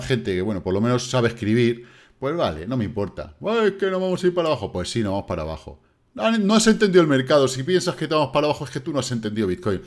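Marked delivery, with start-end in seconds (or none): 3.5-4.7 mocking a critic. 6.2-9.8 mocking a critic.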